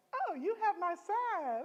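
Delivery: high, slow, sugary mock sweetness; sweet principal voice. Principal reveals her son to the teachers, high and slow. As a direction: draw it out sweetly. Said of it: "she was like".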